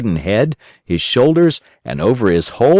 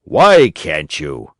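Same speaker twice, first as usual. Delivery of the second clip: put-on lower, slow, stern old father; a father losing patience; Geppetto's voice; 5 semitones lower, 3 dB louder, much slower than usual. A narrator's reading. Geppetto questions through the door.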